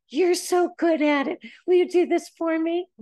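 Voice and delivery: high, loud wheedling flattery; flattering plea. Retold or imitated. Someone blowing smoke to get a favor, wheedling. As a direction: lay on the praise, then beg. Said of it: "Blow smoke right up my ass".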